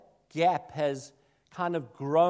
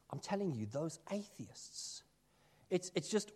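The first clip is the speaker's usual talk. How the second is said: conspiratorial whisper; 9 dB quieter than usual. Shown as a demonstration. Minister mocking blame of atheists, in a gossipy whisper. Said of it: "And those atheists".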